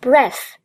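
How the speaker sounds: "breath" modelled as the noun form; noun pronunciation. Shown as a demonstration. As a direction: say "breath" to rhyme with "death". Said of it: "注意不是breath".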